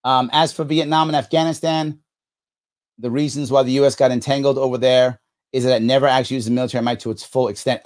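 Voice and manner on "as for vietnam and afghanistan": ordinary talk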